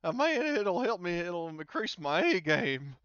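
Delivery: high, loud, eager boast; excited Mike. Acted. Drunk pool player hyping himself up, eager and loud. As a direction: pump yourself up before the shot. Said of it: "he's like".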